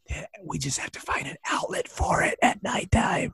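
hushed secret whisper. Acted. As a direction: whisper it like a confession. Librarian confesses nightlife, whispering.

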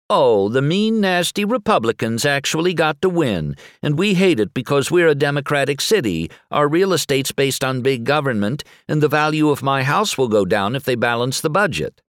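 high, whiny sing-song mockery; sing-song whine. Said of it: "He adopts a sing-song whine to imitate his critics".

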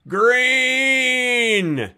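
long, high drawn-out call of one word; high, held-out mock yell; guy yelling from the back. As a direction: imitate a heckler crowing it across a theater. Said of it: "who would just go".

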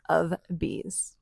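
whisper. Real, not acted. Dramatic whisper.

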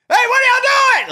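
very high, loud cartoon shout; over-the-top cartoonish yell at the kids. Shown as a demonstration. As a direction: scream it like a cartoon parent. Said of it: "that's cartoonish, that's loud".

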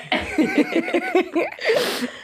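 high, long burst of laughter. Real, not acted.

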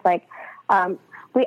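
ordinary talk

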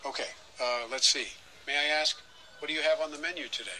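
put-on soft, groggy old Biden; just woken up, mumbling; sleepy Biden voice. Biden ordering.

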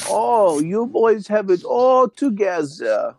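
put-on high-pitched, horrible German accent; mocking German villain. Villain mocking the boys holding out, in German accent. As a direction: sneer in a hammed-up German villain voice.

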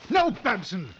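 high, loud shouting rebuke; furious shout. Acted. Hunter yelling at client who fired. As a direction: yell to stop the shot too late. Client fires too early.

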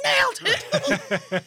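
drawn-out, very high mock shriek; hammed-up scream. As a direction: imitate a screaming fan, stretching it out. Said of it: "people screaming".

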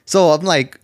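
normal talking voice

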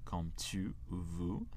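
ordinary delivery

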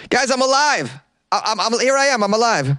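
put-on high, pleading boy insisting he's alive; desperate boy in court gallery; pleading kid. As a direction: beg them to see you.

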